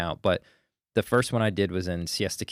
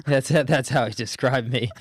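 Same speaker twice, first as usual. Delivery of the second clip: laughing out loud, slow and higher; 6 semitones higher, 7 dB louder, slower than usual. Real, not acted. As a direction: laugh through the line at the insult. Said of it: "the nicest douchebag I know".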